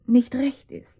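breathy, hushed whisper. Acted. Woman whispering to her lover that it is wrong. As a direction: whisper it so nobody overhears.

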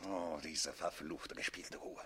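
quiet seething fury. Acted. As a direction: grind the words out in restrained rage. Count seething at calm rival.

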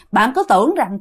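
ordinary delivery, nothing marked

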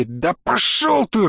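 slow, stretched growl of dismissal; drawn-out, contemptuous outburst; angry roar. Acted. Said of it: "рыкнул Евгений Петрович".

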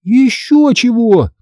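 high, drawn-out indignant retort; outraged "no way" tone. A narrator's reading. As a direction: object like an outraged child. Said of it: "возмутилась я".

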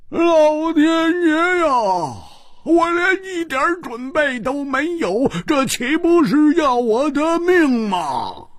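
high, drawn-out cry of despair; dramatic wail. A narrator's reading. Hu Tiehua flopped on the bed unprepared, high drawn-out wail. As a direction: wail to the heavens in comic panic. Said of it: "大呼道".

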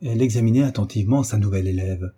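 plain reading voice

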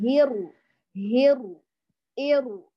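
هيرو with a light, released r; ra with a gap. Shown as a demonstration. Teacher drills students on leaving the gap, careful ra. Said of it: "أهم شيء تأتي بهذه الفجوة".

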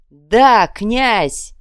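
loud cry hailing the prince; crowd shout. A narrator's reading. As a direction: roar it back as one.

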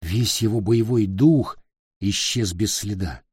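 ordinary delivery, nothing marked

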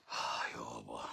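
a breathy sigh of «آه يابا», low and soft; exhausted whisper. Real, not acted. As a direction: let it out as a worn-out whisper. Tired aside after a long answer. (همسة تعب)

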